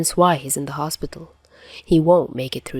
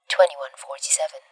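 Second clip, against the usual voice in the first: plain reading voice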